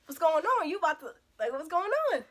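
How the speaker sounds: high, loud impatient demand; impatient questioning. Acted. Acted challenge to stalling date.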